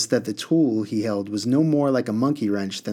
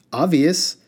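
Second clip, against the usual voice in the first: high, loud, gleeful one-word answer; wicked grin; 6 semitones higher, 5 dB louder than usual. A narrator's reading. Genie teasing the puzzled boy, wicked grin. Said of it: "with a wicked grin".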